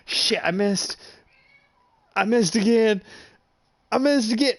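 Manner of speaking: mock panic rising into a loud yell; frustrated. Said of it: "And you're like".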